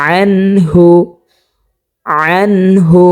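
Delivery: আনহু recited with a clear ain, no nasal sound; measured tajweed reading; Quran recitation. Shown as a demonstration. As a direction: chant it slowly and distinctly. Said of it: "যেমন".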